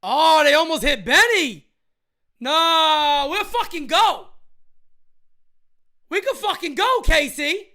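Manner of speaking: loud, heated fan ready to brawl; fired-up anger. Real, not acted. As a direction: get fired up and ready to fight. Angry at pitcher, wants fight.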